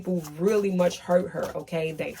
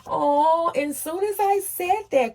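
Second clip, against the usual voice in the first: a sudden, much higher exclaim; surprised delight; 9 semitones higher than usual. Real, not acted. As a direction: caught off guard as the sign comes. A card confirms her words.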